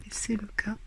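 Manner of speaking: breathy whisper, half to herself; whispered aside. Real, not acted. Reader notes to viewers that the camera moved, whispered. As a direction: drop to a whisper for the camera remark.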